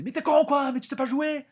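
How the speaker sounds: angry fan bellowing at the team; yelling insults at players. Retold or imitated. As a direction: scream at the players as a rabid fan. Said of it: "je les engueulais en français".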